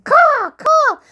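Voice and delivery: high, loud bird squawk; noisy raven. Pretend. Grandparent's raven butts into the bedtime show, noisy squawking. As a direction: caw out like a pushy crow. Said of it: "he's really a noisy bird".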